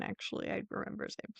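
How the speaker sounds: usual speaking voice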